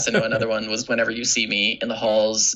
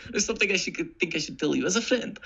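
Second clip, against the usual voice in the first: stage Russian constable, raised pitch; halting Russian accent, close to laughing; 4 semitones higher than usual. Shown as a demonstration. Reciting one of the play's lines, Russian accent. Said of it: "no one else did an accent".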